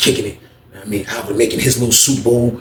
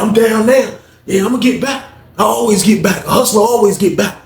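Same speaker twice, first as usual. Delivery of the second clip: high-pitched retold comeback; defensive boast; 6 semitones higher than usual. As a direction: act out insisting he will bounce back. Booker protests after being mocked.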